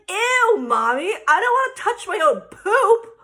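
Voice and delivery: loud, whiny mock-child protest; disgusted kid. Daughter refusing to touch poop, imitated disgusted whine.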